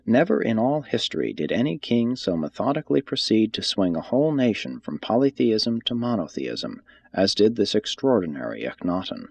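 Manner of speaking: ordinary talk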